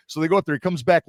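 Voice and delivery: ordinary delivery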